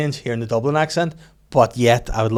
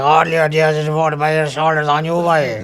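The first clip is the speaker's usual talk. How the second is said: high, loud imitation of a rural Irish local; hammed-up Irish pub regular; thick rural Irish accent; 4 semitones higher, 6 dB louder than usual. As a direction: put on a near-unintelligible country Irish accent. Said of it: "I've never heard anything like it".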